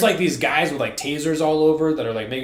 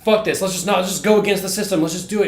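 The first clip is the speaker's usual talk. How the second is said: higher, louder, rushed mock defiance; acted pissed-off urging; 6 semitones higher, 5 dB louder, faster than usual. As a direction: act out urgently talking her into rebelling. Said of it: "He's pissed".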